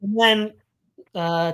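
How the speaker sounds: ordinary talk, nothing marked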